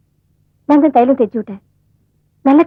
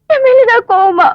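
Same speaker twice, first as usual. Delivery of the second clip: crying out the question, higher and louder; tearful plea; 8 semitones higher, 4 dB louder than usual. Acted. Wife begging her husband through tears, crying. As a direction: voice breaking as she begs to know.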